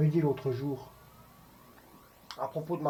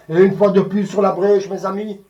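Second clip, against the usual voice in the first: loud, raised-pitch mock declamation; citation shakespearienne déclamée; 5 semitones higher, 12 dB louder than usual. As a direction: proclaim it like a rallying cry on stage. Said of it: "tout Shakespeare que tu me citerais". (déclamation théâtrale à pleine voix)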